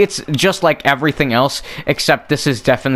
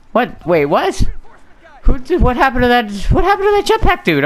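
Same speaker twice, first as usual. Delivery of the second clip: baffled surprise; 13 semitones higher than usual. Real, not acted. Jetpack enemy vanished, baffled.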